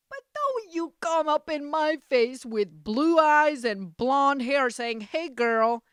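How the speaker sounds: loud, high, hammed-up tirade; mock outraged warning. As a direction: warn them off at full volume. Mock warning rant to blue-eyed blondes.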